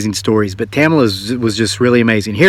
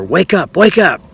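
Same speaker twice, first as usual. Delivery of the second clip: mock angry yell; 6 semitones higher than usual. Mean old man in her dream rousing the sleeper, mock angry yell. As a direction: imitate an angry old man's urgent wake-up call. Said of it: "mean old man yelling at her".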